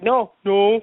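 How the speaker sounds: halting, repeated mock-tearful protest; mock choking up. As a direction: pretend to hold back tears. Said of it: "I'm not gonna cry".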